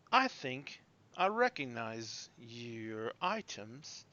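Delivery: slow, soft, considered delivery; young gentleman. Acted. Young gentleman looks down at a customer's items, slow and soft. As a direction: draw it out thoughtfully and quietly. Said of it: "the young gentleman comes walking up".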